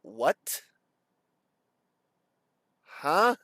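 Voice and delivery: bewildered questions with long frozen pauses, pitch rising; stunned disbelief. Retold or imitated. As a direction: replay being too stunned to understand. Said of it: "I was stunned".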